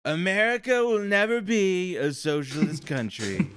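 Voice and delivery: grand rally-style Trump. Retold or imitated. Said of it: "being like".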